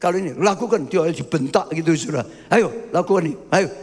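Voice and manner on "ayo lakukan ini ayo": ordinary delivery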